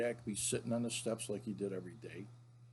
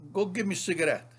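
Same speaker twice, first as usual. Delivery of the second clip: higher, louder grandfather ordering; grandpa impression; 6 semitones higher, 6 dB louder, faster than usual. Grandfather sends little grandson to the store, imitated commanding grandpa voice. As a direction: imitate grandpa sending the kid on an errand. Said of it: "And he'd say".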